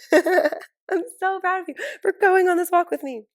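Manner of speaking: high, loud, slow gushing through laughter; high laughing baby talk to the puppy; laughing puppy praise. Retold or imitated. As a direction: overdo the praise, cracking up. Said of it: "I'm like".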